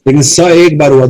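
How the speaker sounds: ordinary talk, nothing marked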